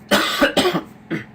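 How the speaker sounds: sudden hoarse cough. Real, not acted. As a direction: cough mid-sentence.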